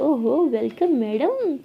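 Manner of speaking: slow, drawn-out mock-grand greeting; singsong teasing hello; teasing welcome. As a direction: stretch the welcome out playfully. Acted teasing welcome at door.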